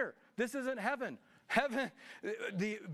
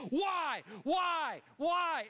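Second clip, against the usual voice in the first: high, drawn-out cries of protest; re-enacted wail at God; anguished questioning; 9 semitones higher, 3 dB louder, much slower than usual. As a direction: cry each one out separately, high. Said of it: "I could still be stuck there saying".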